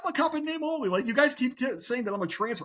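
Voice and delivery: high-pitched, indignant self-quote; retold indignant protest. Retold protest at being belittled.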